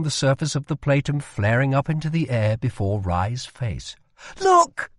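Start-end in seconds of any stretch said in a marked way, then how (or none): 4.4-4.9 sudden high, loud cry of delight; excited shout. Acted. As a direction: burst out at the magic. Rye sees his spell work.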